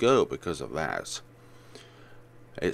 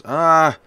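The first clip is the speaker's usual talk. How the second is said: sharp loud cry; 9 semitones higher, 15 dB louder than usual. Acted. Sudden cry.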